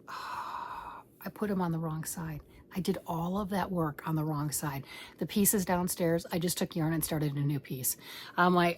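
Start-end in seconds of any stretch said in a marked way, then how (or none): 0.1-4.8 sighing, whispered dismay. Real, not acted. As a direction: breathe it out in a defeated whisper. Realizing she ruined her work.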